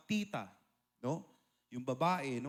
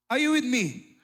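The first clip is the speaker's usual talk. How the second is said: much louder, higher rallying shout; fiery preaching call; 10 semitones higher, 14 dB louder than usual. Real, not acted. Preacher rallying the congregation at full force, loud and high. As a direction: rouse the congregation.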